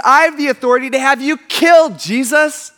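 loud, high assertion of power; high, forceful warning; indignant threat. Retold or imitated. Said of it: "He says".

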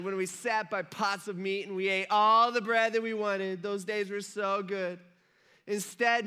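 mock whining complaint with stretched words; whiny. Hungry Israelites blaming Moses in the wilderness, whiny. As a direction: whine accusingly, leaning on the grievance. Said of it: "in a really whiny voice".

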